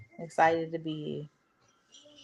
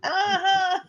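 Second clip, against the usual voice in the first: laughing; 11 semitones higher than usual. Real, not acted. Laughing after mimicry.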